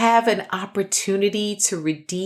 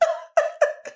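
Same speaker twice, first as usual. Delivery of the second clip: high breathy burst of laughter; real laughter. Real, not acted. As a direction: crack up for real. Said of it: "And we are cracking".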